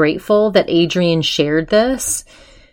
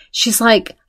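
normal talking voice

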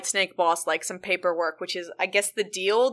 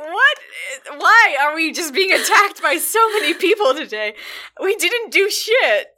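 whiny boss; 7 semitones higher, 5 dB louder than usual. Retold or imitated. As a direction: whine it in outraged disbelief. Boss panics at attack.

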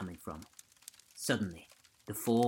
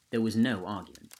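usual speaking voice

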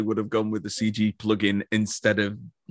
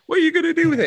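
put-on much higher, louder old-lady squeak; mock old lady; 5 dB louder, much faster than usual. Old lady asking the owl buyers, in a mock high squeak.

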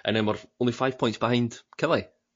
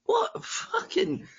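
a sudden, slow, strangled high cry of fury; angry outburst; much slower than usual. Real, not acted. Fan furious at rival fans abusing their manager, outburst. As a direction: burst out in real frustration. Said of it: "Sorry for that outburst there".

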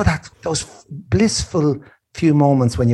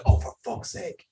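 whispered wince of pain; 7 dB quieter than usual. Retold or imitated. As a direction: hiss it like a sudden cramp. Cramp pain retold.

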